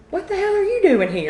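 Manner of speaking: high-pitched, laughing mock shock; shocked exclamation. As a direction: blurt it out in retold disbelief. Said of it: "My one word when I turned around".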